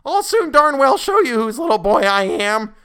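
put-on very high, loud, slow furious boy's voice; ferocious. A narrator's reading. Boy threatens the colonel, very high and loud. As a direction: spit it out in boyish fury. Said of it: "in a ferocious voice".

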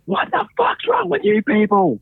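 high, slow mock scream of a woman in trouble; hammed-up high shriek; imitating a high-pitched scream. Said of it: "And it was high pitch".

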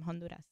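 Honduras said half Spanish, half American; mixed accent. Real, not acted. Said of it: "I don't know why I said Honduras".

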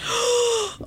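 sharp gasp of fright; shocked gasp. Real, not acted. Reacting to creepy story.

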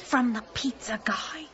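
hushed, voiceless whisper. Acted. Woman whispering a ghost rumor to her neighbor, hushed.

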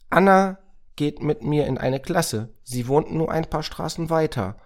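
put-on high, loud, eager child voice; excited boy Moritz. Moritz names classmates to help Samira and Racket, excited.

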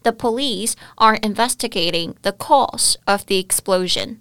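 slow, clear English phrase; slow example. Shown as a demonstration. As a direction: read the example slowly for learners. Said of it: "英文就可以说". (慢慢念英文例句)

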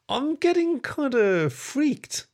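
high, slow, freaked-out voice; uneasy mutter. A narrator's reading. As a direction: draw it out nervously, pitched high. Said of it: "he muttered".

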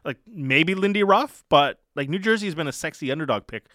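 ordinary talk

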